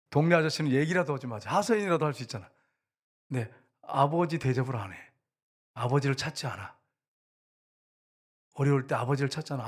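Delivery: ordinary talk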